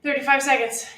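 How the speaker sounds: upbeat gym coach calling out; coach cheering. Acted. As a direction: pump up the class, counting down. Coach cheering through a workout.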